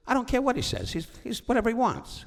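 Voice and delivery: high, loud mock Frank insisting; high hammed-up insistence; emphatic Frank. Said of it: "He said".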